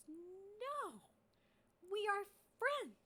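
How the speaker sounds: horrified dragon. Acted. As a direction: gasp it out in horror, pitch shooting up. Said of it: "looks absolutely horrified".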